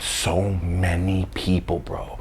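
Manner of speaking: slow, whispered So many people; So many people whispered slowly in wonder; whispered awe. A narrator's reading. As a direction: hush it, stretch it slowly. Awed whisper vs plain repeat.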